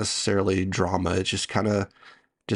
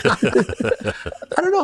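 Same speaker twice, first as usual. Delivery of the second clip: much higher, giggly and sheepish; laughing; 14 semitones higher, much slower than usual. Real, not acted. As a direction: laugh through it, defensive. Defending a movie while laughed at.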